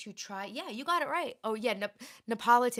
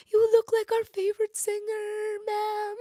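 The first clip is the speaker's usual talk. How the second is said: imitating the women; 11 semitones higher, 6 dB louder, slower than usual. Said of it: "They're like".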